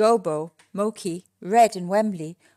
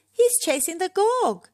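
high, alarmed yelp; high-pitched excited cry; 11 semitones higher, 4 dB louder than usual. A narrator's reading. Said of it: "yelped Wembley".

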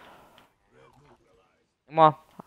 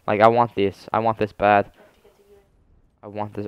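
ordinary talk, nothing marked

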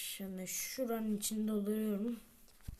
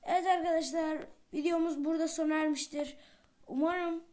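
higher, louder, quick sign-off; upbeat vlog outro; 7 semitones higher, 7 dB louder, faster than usual. Acted. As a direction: wrap up the video with energy. Loud video outro.

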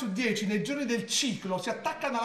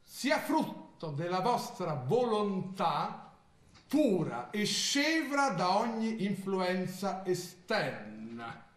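slow, grave ritual reading; slow, solemn reading of a spell manual; solemn instruction reading; 2 semitones lower, 4 dB quieter, much slower than usual. Acted. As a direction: read each step like a sacred rite. Reads a ritual manual aloud.

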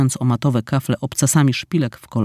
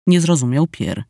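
ordinary delivery, nothing marked

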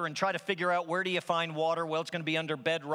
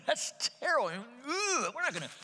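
laughing disgust at the taste, voice jumping high; disgusted grumbling people; 8 semitones higher than usual. Retold or imitated. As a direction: act out the grimace of tasting something awful. People tasting bitter water.

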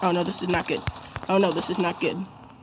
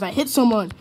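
usual speaking voice

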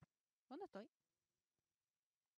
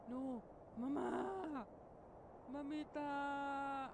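slow, drawn-out lament, louder; mock wail; 5 dB louder, much slower than usual. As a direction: stretch it out grieving for mom. Mother dies in the game.

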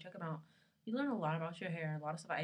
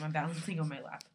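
usual speaking voice

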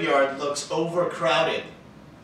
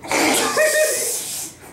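corny dad laugh; 5 dB louder than usual. Real, not acted. Laughs at friend's near miss.

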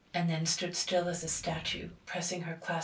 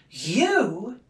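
loud, higher, scornful; scornful disbelief; 5 semitones higher, 12 dB louder than usual. A narrator's reading. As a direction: echo it in mocking wonder. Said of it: "in tones of wonder, scorn, rage".

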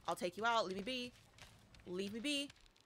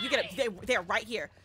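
normal talking voice

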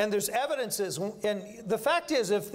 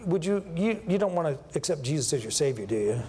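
lower, hesitant, half-hearted asking; timid soul winner; 7 semitones lower than usual. Retold or imitated. Preacher mocks a timid soul winner asking a stranger, lower and hesitant. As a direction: mumble the question as if hoping for no. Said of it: "You don't go to somebody and say".

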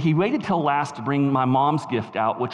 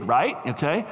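ordinary delivery

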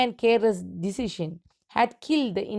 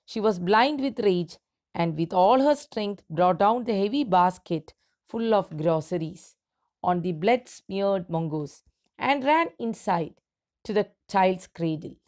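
normal talking voice

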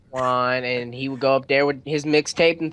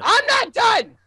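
very high, loud protest; indignant shout; 5 dB louder, slower than usual. Acted. Interrupted friend protests the others moving on, high shout. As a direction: yell it high, cutting in.

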